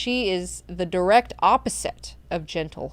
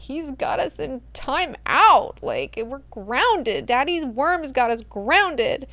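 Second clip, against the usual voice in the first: higher, slow pouty whine; sulky Siona; 7 semitones higher, slower than usual. Retold or imitated. Siona complains about Leto to Duncan, pouty. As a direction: sulk like a grounded teen.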